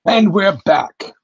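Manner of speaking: high, slow, booming announcer call; big announcer call. Acted. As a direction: stretch it out like a show host. Announcing return, bigger take.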